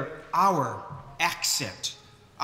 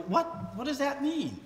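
much higher, softer bewildered question; puzzled guest; 10 semitones higher, 6 dB quieter than usual. Retold or imitated. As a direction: ask it baffled, lost in the idiom. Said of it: "She looked at me like".

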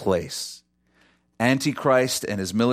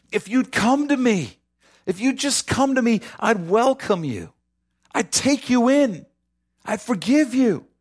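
pleading invitation; 11 semitones higher than usual. Acted. God's pleading voiced in sermon.

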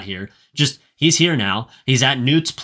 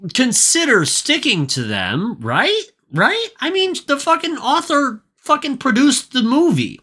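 exasperated disbelief; 12 semitones higher than usual. Real, not acted. Podcaster arguing the author should stick to the rules, exasperated. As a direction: voice climbing high in indignation. Ranting about inconsistent rules.

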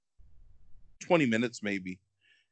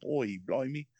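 mock British accent; 3 semitones higher, 4 dB quieter, slower than usual. Shown as a demonstration. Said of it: "let me see if I can do it".

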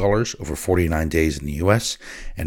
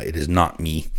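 plain reading voice

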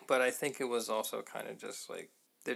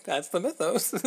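higher, laughing repeat of the line; laughing echo; 7 semitones higher, 5 dB louder than usual. Retold or imitated. Cohost echoing the quote, laughing. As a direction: laugh it out while quoting. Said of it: "echo laughing".

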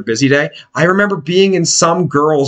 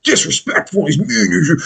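higher, mocking imitation of a hostile kid; mocking kid cook; 3 semitones higher than usual. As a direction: imitate a mouthy young cook. Mimics hostile kid cook mouthing off.

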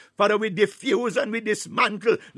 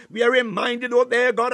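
normal talking voice

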